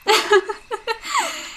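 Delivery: high mocking giggle. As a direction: giggle at your own joke. Dismissing the body-sized bag.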